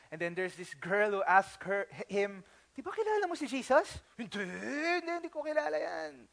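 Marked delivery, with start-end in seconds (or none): 2.7-3.9 higher, fast prying question; accusing girl. Acted. Servant girl questions Peter. 4.2-6.3 softer, flustered denial; nervous denial. Acted. Peter denies knowing Jesus.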